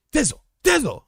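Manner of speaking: high calling voice; imitating Daryl calling. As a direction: call his name way up high.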